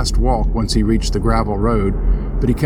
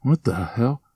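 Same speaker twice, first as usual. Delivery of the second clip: startled whisper; 3 dB quieter than usual. Acted. Startled by a voice in dark.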